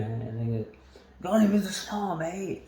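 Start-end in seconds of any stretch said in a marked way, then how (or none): 1.2-2.6 high-pitched, inexact Scottish lad impression; rough go at a Scottish accent; put-on Scottish accent. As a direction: mimic a drunk Scot in a higher voice. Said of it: "that wasn't his accent".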